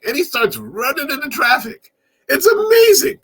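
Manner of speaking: rising disbelief at the crazy scene; incredulous excitement. Real, not acted. Excited retelling of the highway chase.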